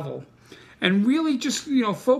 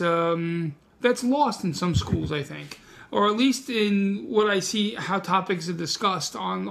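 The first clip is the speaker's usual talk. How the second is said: normal talking voice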